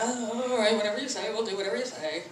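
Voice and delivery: soft, trailing agreement; hushed, meek acquiescence; resigned compliance. Retold or imitated. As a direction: give in quietly, dropping away. Giving in to son's wish.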